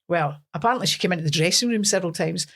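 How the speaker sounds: ordinary talk, nothing marked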